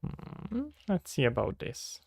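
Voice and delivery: ordinary talk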